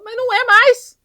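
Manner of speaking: high, loud snap at mother; angry retort. Retold or imitated. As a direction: fire it back at her hard. Ed snaps at his mother.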